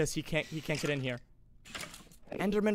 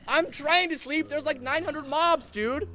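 exasperated whine; 9 semitones higher, 4 dB louder, slower than usual. Real, not acted. Mobs swarm while he sleeps.

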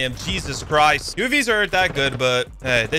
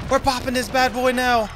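higher, pumped-up second take; hyped repeat; 8 semitones higher than usual. Real, not acted. Gamer hyping his advanced UAV to viewers, higher and excited. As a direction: hype it up for the viewers.

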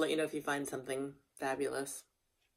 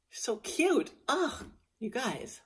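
gushing; 8 semitones higher, slower than usual. Real, not acted. Gushing over her horse.

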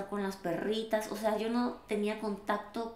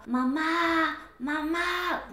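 high drawn-out cry for mom; imitating a scream, held long and high; retold desperate scream; 7 semitones higher, much slower than usual. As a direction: call out for your mother, pitched well up. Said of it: "yo gritaba".

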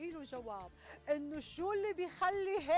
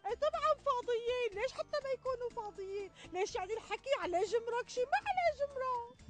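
sarcastic; 6 semitones higher than usual. Acted. Sarcastic reply in argument.

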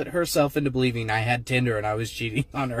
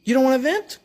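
high, rising teasing voice, getting louder; sarcastic gloating; 14 semitones higher, 4 dB louder than usual. Retold or imitated. Husband teasing wife who suddenly wants help, high, building sarcasm. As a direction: rub it in with mock innocence. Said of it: "I was like".

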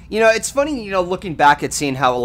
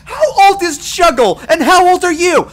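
high, loud, incredulous yelling; outraged yell; 6 dB louder than usual. Retold or imitated. Storyteller retells confronting Breakfast Tea over a mix-up, outraged yell. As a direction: demand answers at a high-pitched yell.

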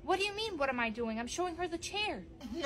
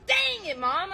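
frustrated outburst; 5 semitones higher, 5 dB louder, slower than usual. Acted. Frustrated with Mom.

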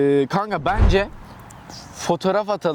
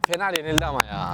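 normal talking voice

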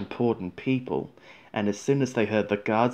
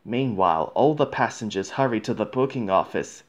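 usual speaking voice

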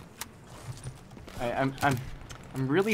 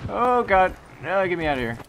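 panicked yelling; 8 semitones higher, 8 dB louder than usual. Real, not acted. Under fire, wants out.

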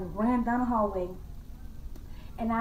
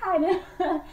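imitated scream; 9 semitones higher, 3 dB louder than usual. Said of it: "my mom just started screaming".

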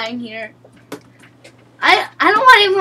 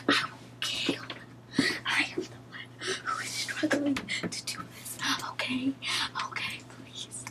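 whisper; 11 dB quieter than usual. Real, not acted. Whispering after losing.

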